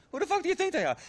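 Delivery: high-pitched exasperation; irritated retort. Acted. Boss answering partner about the goons, irritated. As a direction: throw the question back, pitch leaping up.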